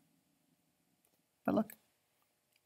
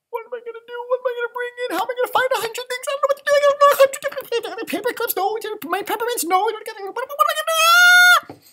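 put-on high, frantic child's voice, racing; panicky little girl voice; 10 dB louder, faster than usual. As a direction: voice Jessica squeaky and panicking. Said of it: "She's like".

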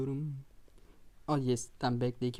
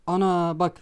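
louder, higher outburst; shocked outcry; 4 semitones higher, 8 dB louder, slower than usual. Real, not acted. Gamer reacting to a conceded goal, shocked outcry. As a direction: blurt it out in disbelief.

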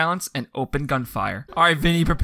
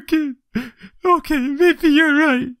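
placating interviewer; 10 semitones higher, 4 dB louder than usual. Acted. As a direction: humour the hysterical woman.

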